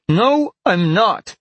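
put-on high, loud, drawn-out girl's denial; indignant denial. A narrator's reading. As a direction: deny it loudly, stretching the words. Girl denies being an animal.

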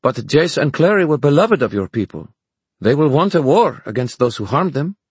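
a skeptical werewolf brushing off fine words. Acted. French werewolf warns Julian.

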